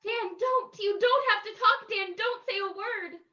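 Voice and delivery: alarmed wife cutting in; urgent plea. Acted. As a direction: beg him to keep silent. Wife stops husband from talking.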